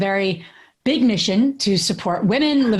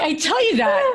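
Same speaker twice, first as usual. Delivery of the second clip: normal talking voice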